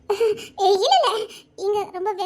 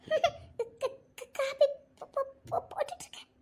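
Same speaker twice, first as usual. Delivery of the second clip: stammering whisper through tears, very slow; tearful stammer; 2 semitones higher, 7 dB quieter, slower than usual. Acted. Caught crying by the husband, stammering a whispered cover story. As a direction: choke out the excuse in a whisper.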